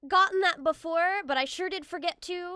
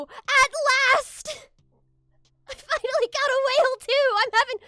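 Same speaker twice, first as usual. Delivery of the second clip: excited; 10 semitones higher, 10 dB louder than usual. Real, not acted. Finally caught a whale.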